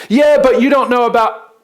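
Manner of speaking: high, loud pleading objection; anxious objection cut off mid-sentence; protesting. Pretend. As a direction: whine out the excuse. Imagined objections, brushed off.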